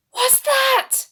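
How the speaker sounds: ordinary delivery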